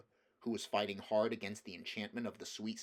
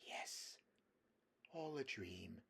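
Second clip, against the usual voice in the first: faint enchanted echo; 11 dB quieter, slower than usual. A narrator's reading. Jill echoes, enchanted.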